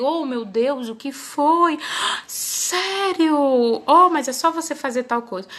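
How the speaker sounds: high, drawn-out gasped "Sério?"; acted astonishment with an audible gasp; mock concerned shock. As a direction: play a dismayed, sympathetic friend, hammed-up. Acts out friend reacting to a mistake.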